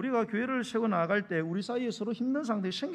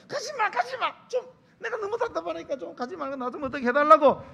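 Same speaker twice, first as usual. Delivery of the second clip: high, loud, frustrated plea; desperate pleading; 8 semitones higher, 8 dB louder than usual. Acted. Girlfriend's real meaning to boyfriend, high desperate plea. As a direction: beg him loudly to stay. Woman's hidden plea voiced.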